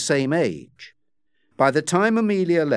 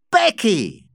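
high, loud exclamation; astonished cry; 7 semitones higher, 5 dB louder than usual. A narrator's reading. Joss recognizing the unmasked woman, astonished high cry. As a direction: cry the name out in surprise. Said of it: "cried Joss".

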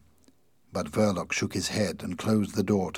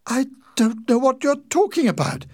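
very high, loud, alarmed denial; horrified cry; 9 dB louder than usual. A narrator's reading. Wife hears of bombing from inspector, horrified cry. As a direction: cry it out in horror. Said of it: "Winnie cried, horrified".

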